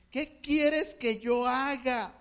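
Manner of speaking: very slow, weighing each word; slow, earnest self-question; slow searching question. Shown as a demonstration. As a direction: ask it slowly as if to yourself. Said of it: "una de las preguntas".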